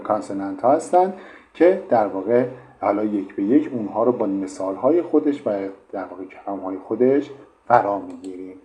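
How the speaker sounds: ordinary delivery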